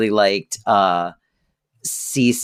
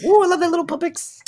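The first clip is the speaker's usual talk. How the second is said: very high, quick coo; cooing grandma; faster than usual. Retold or imitated. Grandma fussing over a little belly button, high cooing. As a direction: imitate a doting grandmother, pitched way up. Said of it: "My grandma used to go".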